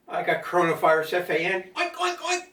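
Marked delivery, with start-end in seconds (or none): 1.8-2.4 high squealy pig oinking; pig oinks. Shown as a demonstration. Man doing funny animal noises, high pig oinks. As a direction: oink like a silly pig. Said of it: "F A N means funny animal noises".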